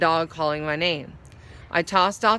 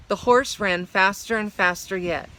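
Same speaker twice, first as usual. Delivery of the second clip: plain reading voice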